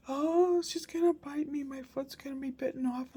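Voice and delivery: put-on high, fretful child voice, lightly done; scared crying child. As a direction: imitate the tired boy's panic, a touch of whimper. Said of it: "His imagination goes crazy when he's tired".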